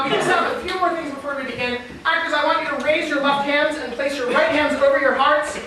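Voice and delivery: normal talking voice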